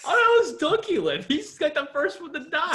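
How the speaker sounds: high-pitched, laughing outcry; laughing protest. Real, not acted. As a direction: shout it while cracking up. Objecting to the plot, laughing.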